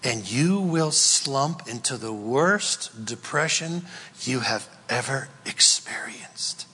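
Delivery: usual speaking voice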